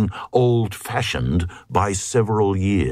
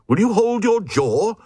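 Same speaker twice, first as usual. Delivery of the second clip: much higher, scolding the Glug; crowd crying out; 6 semitones higher than usual. Acted. Glugs silence the warty Glug with a high scolding cry. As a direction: hush him with a sharp outcry. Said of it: "But the Glugs cried".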